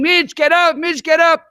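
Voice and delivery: high, loud calling of his name; screaming. Retold or imitated. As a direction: yell up to wake a sleeping man. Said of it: "So I'm screaming".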